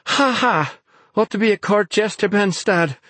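high, breathless mock laugh and jibe; gasping sarcasm. A narrator's reading. Exhausted Naomi mocks Ben's joke, gasping. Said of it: "she gasped".

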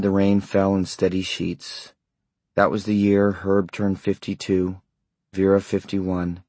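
plain reading voice

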